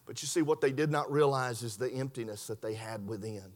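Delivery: very low, soft preaching; solemn hush. Real, not acted. Preacher on the emptiness within, hushed and solemn. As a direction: sink down low and hushed for the point.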